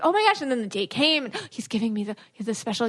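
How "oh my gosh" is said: put-on excited teen. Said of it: "Then I'm like".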